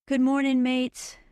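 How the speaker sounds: put-on Australian accent. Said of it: "speaking with an Australian accent".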